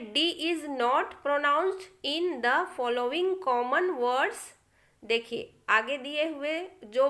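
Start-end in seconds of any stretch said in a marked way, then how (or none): 0.1-4.4 very slow dictation; slow rule reading. Shown as a demonstration. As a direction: read the rule slowly to the class. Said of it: "rule टू देखिए". (धीरे नियम पढ़ना)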